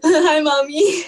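slow, loud laughing greeting; drawn-out giggly hi to mom; laughing hello. Real, not acted. To her mom listening later, a loud, slow laughing hello.

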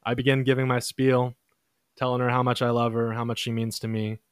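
ordinary talk

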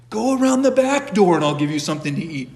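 high, loud exasperated order; mock scolding. Saint sending his bear round back, loud mock scolding. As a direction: order it off loudly, as if scolding a pet.

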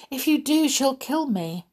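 high-pitched, alarmed plea; pleading cry. Acted. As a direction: warn them fearfully, up high.

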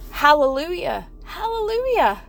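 high-pitched hallelujah of praise; exultant praise. Real, not acted. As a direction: lift it upward in worship. Praise at the peak of preaching.

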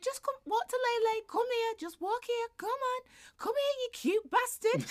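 put-on endearing toddler voice. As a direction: coax a baby brother like a small child. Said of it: "She went".

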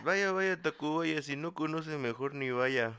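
high, bouncy playground chant; high singsong rhyme. Pretend. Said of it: "Pero todo completo".

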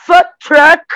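loud, high American English; American accent. Retold or imitated. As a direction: shout food truck with an American accent. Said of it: "totalmente no sotaque americano".

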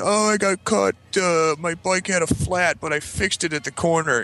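put-on high, drawn-out childish excuse; kid making excuses. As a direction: mimic a kid explaining himself to dad.

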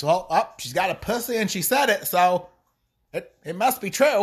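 sneering singsong mock quote. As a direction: mimic them with scorn. Mocking the people who believe her.

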